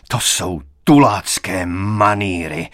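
slow, contained outburst; understated furious exclamation, words drawn out; angry cry. A narrator's reading. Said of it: "vykřikl". (rozhořčené zvolání, mírně)

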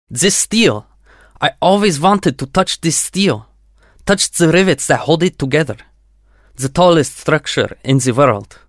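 put-on earnest Russian-accented voice. A narrator's reading. Yuri continues his speech.

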